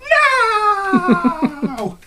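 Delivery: high, loud laughing shout; kids yelling. Retold or imitated. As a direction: yell it high through a laugh.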